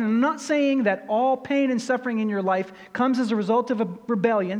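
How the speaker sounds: ordinary delivery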